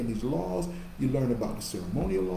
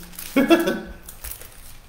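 a high breathy burst of laughter; amused laugh; 11 semitones higher, 6 dB louder than usual. Real, not acted. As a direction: laugh at yourself. Laughs at his own slip.

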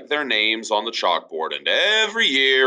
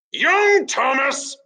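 high, slow angry teacher; fuming teacher; slower than usual. Acted. Teacher Douglas fuming at Tom, high and slow. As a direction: seethe out the name. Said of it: "fumed Teacher Douglas".

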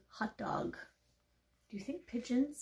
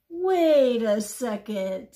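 loud, drawn-out "Wait", falling away; sudden suspicion; 11 dB louder, much slower than usual. Acted. Pigeon realising the duck may dupe it, suspicious. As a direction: halt slowly and loudly, catching on.

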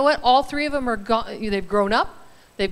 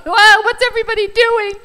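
high, loud mock-lost wondering; a bewildered retiree; imitating her future bored self; 9 semitones higher, 9 dB louder than usual. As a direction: play herself adrift in September, calling a friend. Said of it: "I'm sure I'll be calling Stephanie and going".

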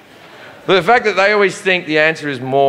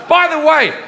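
ranting shout; 9 semitones higher than usual. Real, not acted. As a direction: yell it at the crowd, exasperated. Ranting at gun owners.

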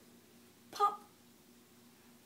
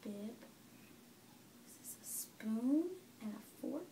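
put-on quiet, gentle questions to a baby; soft parent voice; 4 dB quieter than usual. Parent quietly quizzes the baby on body parts and objects. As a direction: ask softly, near a whisper.

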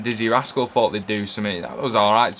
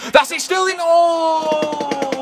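excited shout; 11 semitones higher, much slower than usual. Real, not acted. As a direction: shout as the chance opens up. Player breaks through on goal.

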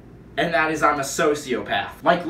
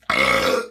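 sharp gasp; 5 dB louder than usual. Real, not acted. Sees bleeding toe.

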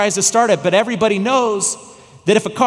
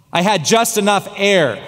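ordinary talk

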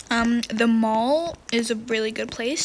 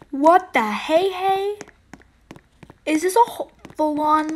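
slow, high, louder surprise; surprised; 4 semitones higher, 4 dB louder than usual. Real, not acted. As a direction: draw it out in disbelief. Surprised by big field.

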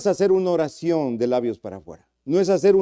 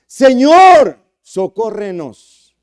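loud, higher cry for help; desperate plea; 6 semitones higher, 10 dB louder, slower than usual. Acted. Preacher acting out a desperate believer crying to God, loud. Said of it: "Implica gemir".

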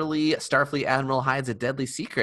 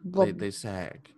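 slow saggy-cheeked voice; heavy jowls dragging the words; jowly old voice; much slower than usual. Shown as a demonstration. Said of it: "you can hear my cheeks are fatter and heavier".

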